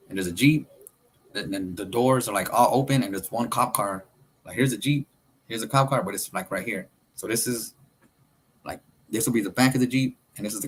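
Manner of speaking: usual speaking voice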